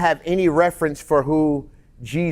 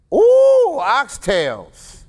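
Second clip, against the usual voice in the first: excited ooh; 12 semitones higher, 5 dB louder, much slower than usual. Real, not acted. Listener reacts to favorite food.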